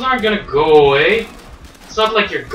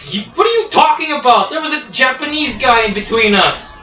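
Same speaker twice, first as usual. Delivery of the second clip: heated protest; 9 semitones higher than usual. Real, not acted. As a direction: argue back in disbelief. Arguing over a teammate kill.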